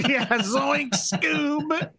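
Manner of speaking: lightly voiced high cartoon slacker; a hint of a goofy cartoon teen; Shaggy. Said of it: "impression of Shaggy".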